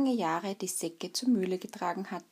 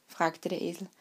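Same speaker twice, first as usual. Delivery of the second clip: ordinary delivery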